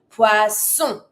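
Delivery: "Poisson" with a long, strong S; emphasized S sound. Shown as a demonstration. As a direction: lean on the S of "Poisson": "pwa-sson". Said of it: "really try to emphasize on the S sound".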